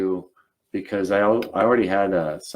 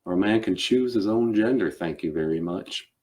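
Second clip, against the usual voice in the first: put-on Southern accent. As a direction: stand firm as a gentlemanly Southerner. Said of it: "I was like".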